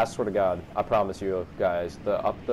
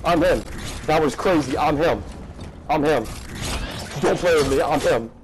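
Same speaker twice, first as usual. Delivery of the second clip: loud, raised-pitch victory chant; triumphant boasting; 5 semitones higher, 5 dB louder than usual. Real, not acted. Gamer crows after a big play, triumphant boasting. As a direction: crow it loudly over and over.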